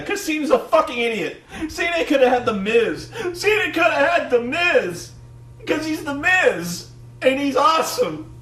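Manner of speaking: high, loud mock tirade; hammed-up outrage breaking into laughter; blaming rant. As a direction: imitate a worked-up commentator building his case. Acted commentator rant of blame.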